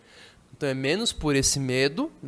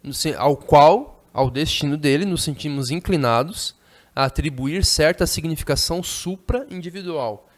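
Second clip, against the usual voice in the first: reading a novel aloud; 6 dB louder than usual. Acted. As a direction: savor each clause of the text.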